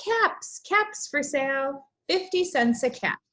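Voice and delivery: sing-song street vendor's call, high on the first caps; peddler calling out wares. Acted. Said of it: "he called".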